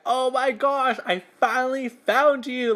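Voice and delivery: high, gushing starstruck outburst; retold squeal of finally meeting an idol; inner excitement. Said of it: "inside my mind".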